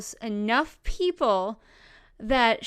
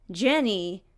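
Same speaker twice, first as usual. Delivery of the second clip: ordinary talk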